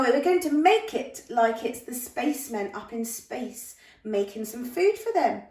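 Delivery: ordinary delivery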